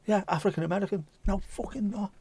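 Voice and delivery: imitating exasperated insistence and denial; mock exasperated argument. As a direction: act out a stubborn back-and-forth, voice climbing.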